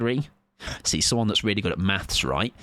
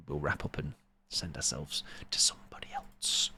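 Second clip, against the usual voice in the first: a hushed aside, much softer; whisper; 8 semitones lower, 11 dB quieter than usual. Real, not acted. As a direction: drop to a whisper for the plan.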